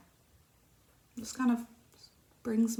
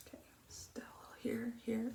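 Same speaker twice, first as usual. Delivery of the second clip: whisper; 3 semitones higher, 9 dB quieter than usual. Real, not acted. Pointing at makeup spot.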